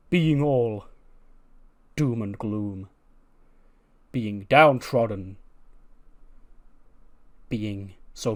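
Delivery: slow, stately rallying speech; measured, weighty pronouncement; grandiose orator. Acted. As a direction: declaim it deliberately, word by word. Voices Keith's grand rallying speech.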